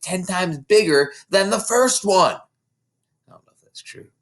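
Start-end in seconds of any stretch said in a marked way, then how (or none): 2.3-4.1 soft unvoiced aside; whispered aside. Real, not acted. Reader whispering to the child beside her, softly. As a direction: whisper it as a private doubt.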